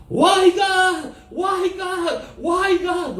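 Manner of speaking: high, drawn-out mock wail to God; imitated anguished cry. Retelling bombed families crying to God, imitated high cry. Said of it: "berteriak semua".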